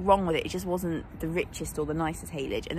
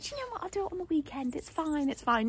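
high, airy, carefree dismissal; breezy excuse; 11 semitones higher than usual. Retold or imitated. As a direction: brush it off lightly as a lazy past self. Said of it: "I was like".

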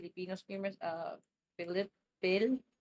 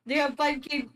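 surprised; 7 semitones higher, 4 dB louder than usual. Real, not acted. Shocked at big streamer.

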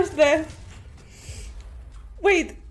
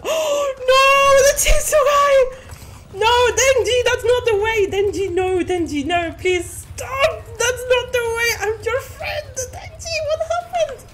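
mock pleading shout; 6 semitones higher than usual. Real, not acted. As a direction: beg the chainsaw guy to stop. Pleading with chainsaw enemy.

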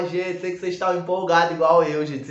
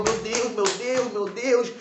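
nervous; 6 semitones higher, slower than usual. Retold or imitated. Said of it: "Eu tava nervoso".

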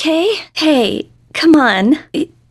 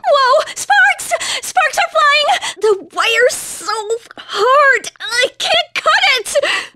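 much higher, frantic cries over sparks; panicked yelps; 12 semitones higher, slower than usual. Acted. Maid panicking while cutting a sparking wire, afraid. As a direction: squeal in alarm as the wire sparks.

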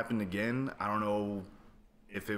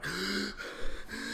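sharp high gasp for air; 12 semitones higher than usual. Retold or imitated. Waking from sleep paralysis, a sharp high gasp. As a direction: gasp like you suddenly can't breathe.

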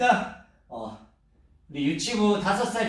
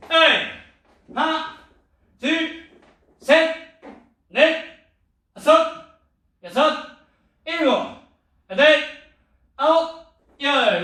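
loud, high drill count; shouted count; 9 semitones higher, 6 dB louder, slower than usual. Acted. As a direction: bark out the count for the class.

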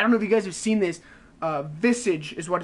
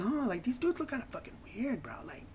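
hushed, voiceless whisper; mock-hushed whisper about the weird visuals; whispered aside; 3 semitones higher, 12 dB quieter than usual. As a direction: whisper it like a secret doubt.

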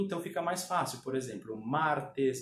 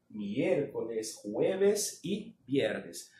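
slow, drawn-out recap of the days; slow, deliberate review; 2 semitones lower, much slower than usual. Shown as a demonstration. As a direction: stretch each day out for students.

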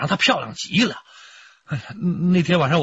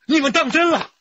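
alarmed disbelief; 10 semitones higher than usual. Acted. Shocked they took it seriously.

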